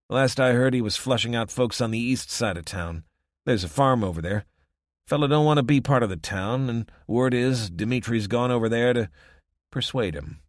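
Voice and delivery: a lightly voiced wary local; weary small-town man, half-reluctant; rough townsman voice. Townsman tells of Dimitri.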